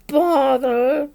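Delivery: exasperated bear, head stuck; Pooh stuck in jar. A narrator's reading. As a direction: grumble it from inside a jar. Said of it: "he said inside the jar".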